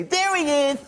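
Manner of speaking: high, lisping boxer impression; Mike Tyson. Boxer greeting the comic afterwards, Mike Tyson impression. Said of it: "then Mike comes up to me".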